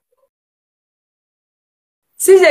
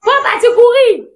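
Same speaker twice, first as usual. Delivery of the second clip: urgent yelling; 3 semitones higher, slower than usual. Real, not acted. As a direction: yell at them to keep running. Repeatedly urging partner to run. (crier d'urgence, aigu et fort)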